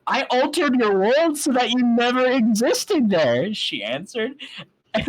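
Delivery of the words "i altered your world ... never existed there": grand princess. Acted. Celestia voiced, grand.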